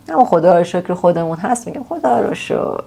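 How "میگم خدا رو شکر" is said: "خدا رو شکر" high and sighed, a mock complaint; grumbling thank God. Shown as a demonstration. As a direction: moan "خدا رو شکر" half-joking.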